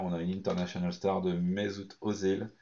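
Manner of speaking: normal talking voice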